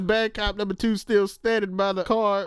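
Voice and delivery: normal talking voice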